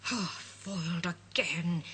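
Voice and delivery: low, slow growl of a foiled villain; a thwarted villain grumbling; angry growl. Acted. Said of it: "he growls angrily".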